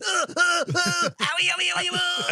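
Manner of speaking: very high comic yelping; hammed-up squeals of pain while dying; mock dying wails.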